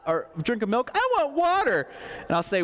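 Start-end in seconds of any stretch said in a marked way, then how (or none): 0.9-1.9 put-on high, whiny child demand; whiny kid. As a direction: mimic a whining child. Kids whine about milk.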